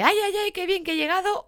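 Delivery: playful over-excited welcome home; mock gushing greeting. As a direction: gush over the dog like it's a big reunion. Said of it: "Ya sea de".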